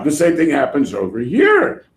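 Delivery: usual speaking voice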